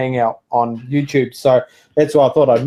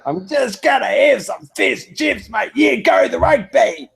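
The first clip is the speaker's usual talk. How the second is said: hammed-up Aussie voice, much higher, slow and shouty; mock Australian accent; 10 semitones higher, slower than usual. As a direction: overdo the Australian accent, stretching every word. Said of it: "they sometimes emphasize things a bit too much".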